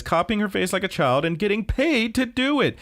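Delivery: high, climbing mock outrage; hammed-up nagging superior; scolding boss. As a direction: build to an indignant high finish.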